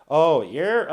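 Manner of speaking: hint of a Fargo accent; failed Fargo accent. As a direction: have a half-hearted go at Fargo Midwest. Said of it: "I can't do the voice in Fargo".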